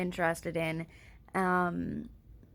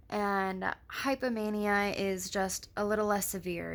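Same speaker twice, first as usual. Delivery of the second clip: normal talking voice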